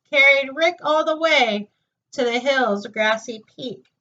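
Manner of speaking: ordinary delivery, nothing marked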